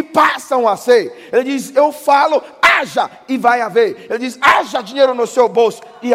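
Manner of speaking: loud, high, shouting pulpit proclamation; full-force preaching. Acted. Preacher proclaiming to the church that God creates from nothing, full-force shouting. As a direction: preach it out over the congregation.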